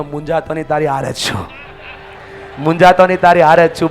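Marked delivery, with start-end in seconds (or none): 2.6-3.9 louder, firmly projected line; emphatic repeat. Shown as a demonstration. Speaker has neighbours reassure each other, loud emphatic prompt. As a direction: say it out loud for a crowd to follow. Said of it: "ખંભા ઉપર હાથ મૂકીને કહો".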